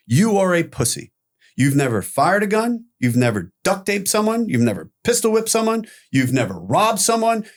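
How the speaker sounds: scornful pile-on, acted out; retold berating. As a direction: hammer each accusation like a bullying crew. Crew berate him in retold quarrel.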